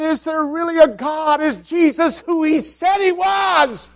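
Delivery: high, loud doubting cry; anguished questioning. Retold or imitated. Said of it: "And I just said".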